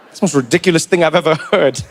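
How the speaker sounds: ordinary talk, nothing marked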